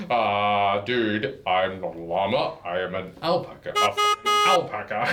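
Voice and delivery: low, offended alpaca voice; alpaca correcting. Retold or imitated. Alpaca correcting the storyteller about llamas, low and offended. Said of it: "And he's like".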